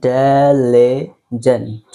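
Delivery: diligent stretched out very slowly, syllable by syllable; slow model pronunciation. Shown as a demonstration. Teacher modelling a word for students, very slow. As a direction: spell out diligent slowly for the class. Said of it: "আমার সাথে বলার try করেন".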